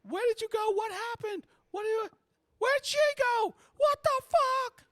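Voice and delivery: very high, loud falsetto; Abby losing it. Acted. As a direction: shriek frantic questions in falsetto. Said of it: "like, literally losing it".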